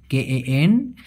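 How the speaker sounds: very slow, letter by letter, K A N D U N G; spelled out slowly. Shown as a demonstration. Teacher spelling the Malay word for students, slow and deliberate. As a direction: spell each letter of K A N D U N G apart with long gaps. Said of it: "উচ্চারণটা হবে".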